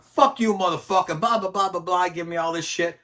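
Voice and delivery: retold gang taunts, hollered; mock hostile yell. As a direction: bark the insults back like the gang did. Gang members cursing at him.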